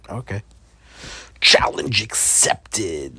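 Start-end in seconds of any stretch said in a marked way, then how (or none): 1.4-3.2 dramatic. Real, not acted. Dramatic acceptance of challenge.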